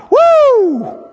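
high joyful whoop; preacher whooping with delight; excited whoop. Real, not acted. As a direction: let out a real whoop of joy. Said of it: "That's some great stuff".

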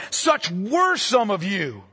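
slow, "were" leaned on with a fall; stress on were. Shown as a demonstration. Preacher rereading the verse to the congregation, stressing "were". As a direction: draw out and underline "were". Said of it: "Underline were".

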